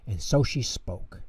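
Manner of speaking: usual speaking voice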